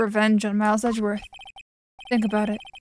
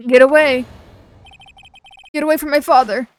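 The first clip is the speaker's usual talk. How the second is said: much louder, higher frantic yell; desperate shout; 4 semitones higher, 9 dB louder than usual. Acted. Boy shouts at the attacker to leave his father, yelling. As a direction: scream to drive the attacker off.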